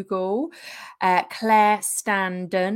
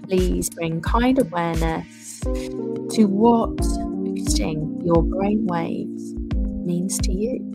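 guided meditation. Acted. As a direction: ease the listener into relaxing. Guided meditation over music.

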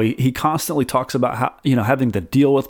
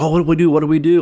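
higher and louder mock panic; freaking out; 4 semitones higher, 4 dB louder, much faster than usual. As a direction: flap about helplessly, hammed-up. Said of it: "freaking out and he's like".

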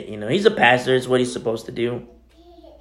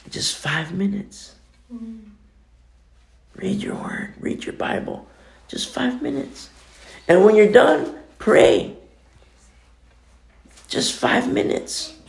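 soft urging; 6 semitones higher than usual. Retold or imitated. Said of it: "same thing that I say to everybody".

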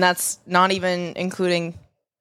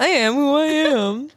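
high, slow, proud and drawn out; sassy mock declaration; 7 semitones higher than usual. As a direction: declare it like a diva. Echoing the defiant declaration.

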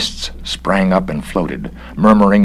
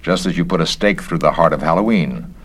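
ordinary delivery, nothing marked

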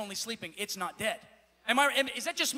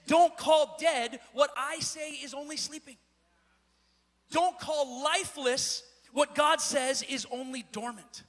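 slow, weighted refrain building louder; emphatic preaching; 4 dB louder, slower than usual. Real, not acted. Preacher correcting those who call it dead, emphatic preaching. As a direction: hammer each "don't", then stretch out the answer.

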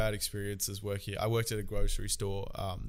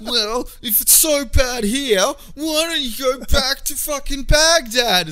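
loud, high, drawn-out mocking outburst; 12 semitones higher, 9 dB louder, slower than usual. Friend parodying a hostile go-back-home remark, mock rant. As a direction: exaggerate it slowly, pitched way up and loud. Acting out a hostile racist quote.

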